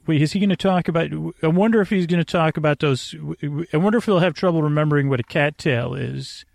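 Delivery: normal talking voice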